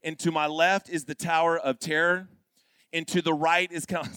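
normal talking voice

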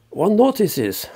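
put-on high, loud declaiming of a letter, no German accent; loud quoted reading, no accent. Reading Wagner's letter aloud, loud and high declaiming. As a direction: proclaim the quote grandly, in plain English. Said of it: "I won't do the German accent".

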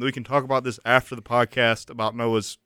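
loud, high, fast protest. Real, not acted. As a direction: shut it down loudly, flustered. Protesting loudly, embarrassed.

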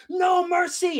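high, loud war shout; hammed-up battle shout; battle cry. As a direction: yell it as the shields charge.

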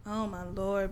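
ordinary talk

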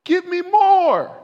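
crying out in prayer. Retold or imitated. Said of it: "he was crying out for".

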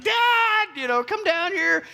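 put-on very high-pitched child calling out; whining kid. Fighting kids summoning their dad, whiny high call. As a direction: imitate a child hollering for a parent.

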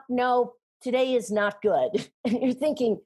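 normal talking voice

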